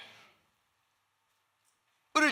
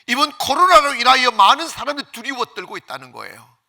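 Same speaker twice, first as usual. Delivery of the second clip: loud, higher-pitched sermon delivery; raised pulpit voice; forceful preaching; 4 semitones higher, 9 dB louder than usual. Real, not acted. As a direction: preach it out with urgency to the congregation.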